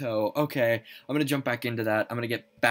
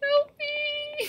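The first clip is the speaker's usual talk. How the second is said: tiny, extremely high squeal; very high scared whimper; frightened plea. Real, not acted. Scared plea during horror game.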